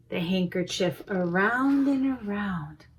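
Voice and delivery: ordinary delivery, nothing marked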